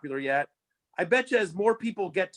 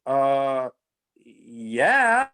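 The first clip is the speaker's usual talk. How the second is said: lower, louder, dazed drawn-out yeah; stunned mock yes; 4 semitones lower, 5 dB louder than usual. Fan answers the director's movie offer, stunned. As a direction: answer like it hasn't sunk in.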